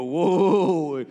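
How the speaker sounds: loud, amazed gasp of wonder; awed whoa. Retold or imitated. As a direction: blurt it out, bowled over. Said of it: "make you go".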